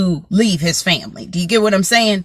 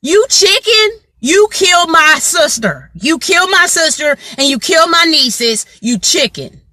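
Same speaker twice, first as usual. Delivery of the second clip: accusing brother; 6 semitones higher than usual. Retold or imitated. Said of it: "He's like".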